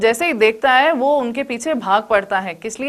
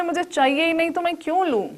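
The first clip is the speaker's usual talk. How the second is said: irritated refusal; 6 semitones higher, 4 dB quieter, faster than usual. Retold or imitated. Exasperated refusal, higher pitch.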